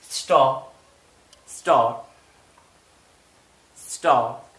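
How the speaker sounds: स्टॉप with no r sound, clipped; teacher models स्टॉप without any r; silent r. Shown as a demonstration. Teacher shows students स्टॉप dropping the r. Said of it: "आप उन तक अपने sound को महदूद रखें".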